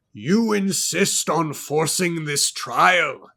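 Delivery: put-on slow, loud, lofty accented voice; lofty, thick accent. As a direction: stretch each word out grandly. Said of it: "keeping his voice lofty, bolstering his thick accent".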